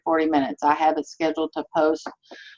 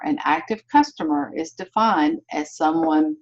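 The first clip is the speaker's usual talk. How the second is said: ordinary talk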